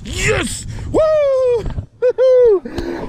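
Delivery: very high victory whoops; real jubilation. Real, not acted. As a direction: whoop with joy at the win. Celebrates the catch.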